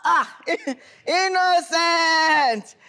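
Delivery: drawn-out laughing call of the name, slow; laughing plea. Acted. Borrower laughing as he begs Innocent for money, drawn out. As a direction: stretch the name with a nervous laugh. Pleading with friend on phone.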